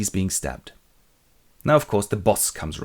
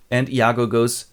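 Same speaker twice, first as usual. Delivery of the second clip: ordinary talk, nothing marked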